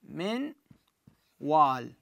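من وال with a nasal ghunna into the و; tajweed demo. Shown as a demonstration. Teacher introducing the واو example. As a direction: keep the nasal hum as من glides into وال. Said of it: "كذلك بالواو عندما نقول".

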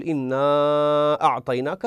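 إنا أعطيناك with إنا stretched five counts, slow; five-count madd. Shown as a demonstration. Teacher demonstrating connected separated madd, recited. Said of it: "فأمد مدا فرعيا خمس حركات".

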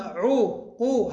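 عو and قو as separate syllables with u; damma on first letter. Shown as a demonstration. Teacher showing الفاء مضمومة, isolated u syllables. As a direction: sound out عو, قو with a clear u vowel. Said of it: "تبقى الفاء مضمومة".

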